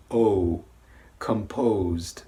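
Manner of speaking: O said with protruding lips, then composed; rounded O demo. Shown as a demonstration. Teacher modelling the rounded O for learners. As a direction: push the lips forward on O, composed. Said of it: "my lips protrude forward".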